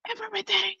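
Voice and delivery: breathy whispered aside; whispered "Everything.". Real, not acted. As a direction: drop to a whisper for effect. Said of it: "whispered emphasis aside".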